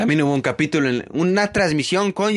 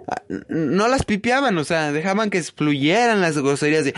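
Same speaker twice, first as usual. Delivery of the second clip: ordinary talk, nothing marked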